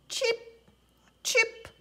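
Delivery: high, chirpy chip; high bird chirp; sparrow chirp. Acted. Sparrow call repeated.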